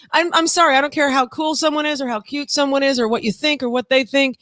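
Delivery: ordinary talk, nothing marked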